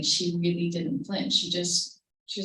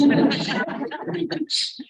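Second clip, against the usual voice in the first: laugh; 10 semitones higher, 5 dB louder than usual. Real, not acted. Fear is funny, only afterwards.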